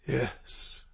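faint, soft, feeble yes; weak voice. Acted. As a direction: barely get it out. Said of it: "Clay said in a weak voice".

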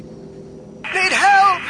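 high-pitched distress scream. A narrator's reading. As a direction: scream for help over the radio. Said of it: "screaming across all channels".

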